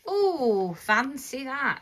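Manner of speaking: high, slow mock-posh ooh; a hammed-up impressed ooh.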